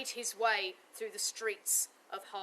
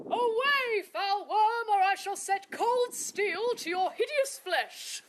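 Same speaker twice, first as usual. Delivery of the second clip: high, loud knightly command; heroic challenge; 11 semitones higher, 6 dB louder than usual. Acted. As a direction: bellow it like a noble knight. Knight tries again, louder.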